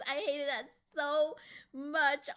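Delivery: ordinary talk, nothing marked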